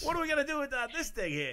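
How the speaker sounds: high put-on Liverpool voice; [name] voice. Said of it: "He's gonna be like".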